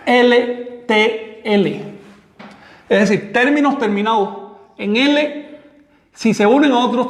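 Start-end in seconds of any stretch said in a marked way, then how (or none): 0.0-1.8 "L T L" spelled out very slowly, letter by letter. Shown as a demonstration. Teacher naming the banned combination, each letter slow and separate. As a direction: space out "L T L" one letter at a time. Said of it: "pronunciar la combinación".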